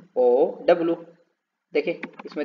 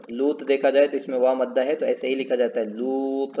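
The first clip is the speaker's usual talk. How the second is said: ordinary talk, nothing marked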